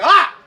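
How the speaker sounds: sudden loud shriek of fright; loud startled scream; startled yelp. Retold or imitated. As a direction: jump out of your skin with a yelp. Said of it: "I was like".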